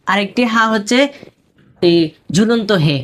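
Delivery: usual speaking voice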